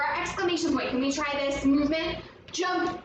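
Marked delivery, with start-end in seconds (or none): none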